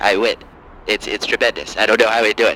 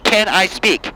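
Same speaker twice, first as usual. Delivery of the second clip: higher, exasperated caller; angry liberal caller; 4 semitones higher than usual. Acted. Caller fighting to be heard in a higher exasperated voice. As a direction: demand the floor, voice up. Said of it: "Angry Lib".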